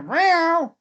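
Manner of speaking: high, loud mock meow; imitated meow. Streamer retelling the cat's entrance with a high meow. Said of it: "Cat comes in here".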